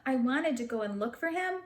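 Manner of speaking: usual speaking voice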